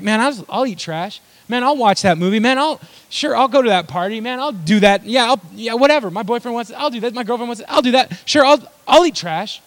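mock 'yeah, whatever' bravado; careless giving in. Acting out giving in to anything.

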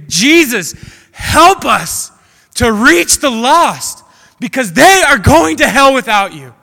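crying out loud in prayer for the lost; yelling prayer. Pretend. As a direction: yell it out to Jesus. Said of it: "just gotta yell and just scream".

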